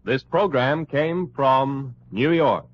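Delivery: ordinary talk, nothing marked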